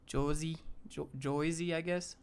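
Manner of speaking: "Joyzy", tried out tentatively and softly; "Jersey" turned into "Joyzy". Shown as a demonstration. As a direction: say "Jersey" with an OY vowel, JOY-zee. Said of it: "think about saying the word Jersey with".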